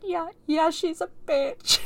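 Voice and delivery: high-pitched tearful wail, hammed up; mock crying. Retelling a daughter on the phone describing her mom, mock crying. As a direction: imitate crying, dragging out the last words. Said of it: "she's, like, crying".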